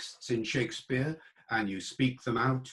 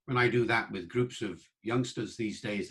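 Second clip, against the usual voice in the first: ordinary talk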